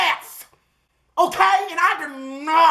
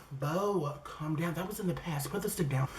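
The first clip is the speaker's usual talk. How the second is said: very soft, low aside; calming aside; 5 semitones lower, 15 dB quieter than usual. Acted. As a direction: hush them down in a low, tiny voice. Inner voice soothing the storyteller.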